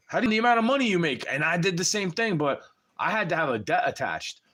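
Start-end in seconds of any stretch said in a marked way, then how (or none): 0.1-1.3 fast angry. Real, not acted. Angry rant, fast outburst.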